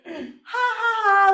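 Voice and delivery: high loud mocking laugh; cocky laugh. Fooling around on the cliff, high cocky laugh. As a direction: laugh it off cockily.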